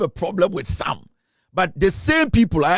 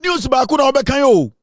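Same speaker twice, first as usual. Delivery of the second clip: mock excitement; 7 semitones higher, 4 dB louder than usual. Mocking the host's outfit list.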